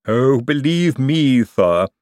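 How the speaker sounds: slow, loud lisping assurance; drawn-out lisping servant voice; Igor, avuncular. Acted. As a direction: lisp it knowingly, stretching the words. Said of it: "gave an avuncular little chuckle".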